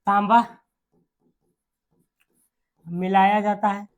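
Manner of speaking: word by word, long pauses; dictation. Real, not acted. Dictating notes to students.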